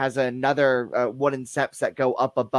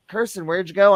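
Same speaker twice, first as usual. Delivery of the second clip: higher-pitched searching call; raised-pitch mock call; retold calling out; 7 semitones higher, 4 dB louder than usual. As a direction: imitate calling to someone in another room. Retells calling out to Kirsten.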